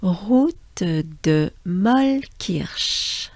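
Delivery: Route de Mollkirch very slow, throaty r; breathe, then slowly Route de Mollkirch; very slow together. Shown as a demonstration. Teacher guiding learners, very slow. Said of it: "Take a good breath with me".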